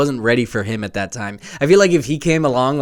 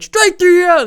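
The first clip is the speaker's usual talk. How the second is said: high mocking yell of strike three; quoting the catcher, high and loud; mock catcher taunt; 6 dB louder than usual. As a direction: squeal it like a taunting kid catcher. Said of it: "he's like".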